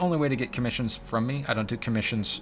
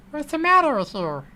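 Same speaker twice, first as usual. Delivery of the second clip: silly cartoon voice. Streamer teasing Art for disliking voices, high silly voice. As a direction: put on a squeaky, mock-menacing little voice. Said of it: "You don't like voices?".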